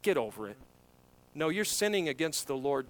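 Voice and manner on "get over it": dismissive shrug. Retold or imitated. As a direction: wave it away as nothing important. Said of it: "where we tend to think".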